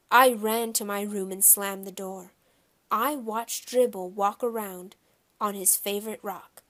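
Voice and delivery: ordinary delivery, nothing marked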